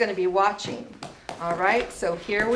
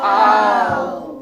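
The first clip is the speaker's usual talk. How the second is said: really low voice; 4 semitones lower, 5 dB louder than usual. Shown as a demonstration. Teacher shows the class a really low voice. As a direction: lower and louder, a deep boom. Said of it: "And now say it in a really low voice".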